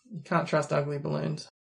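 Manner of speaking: normal talking voice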